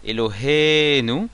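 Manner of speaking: Eloheinu with HE long and stressed. Shown as a demonstration. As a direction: lean hard into the he of "Eloheinu". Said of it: "l'accent tonique".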